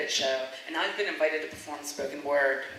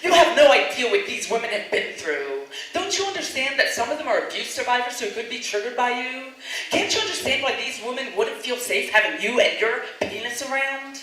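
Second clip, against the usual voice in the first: loud, cutting, condescending rebuke; terse and condescending; 6 semitones higher, 6 dB louder than usual. Retold or imitated. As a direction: scold him with contempt. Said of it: "her tone terse and condescending".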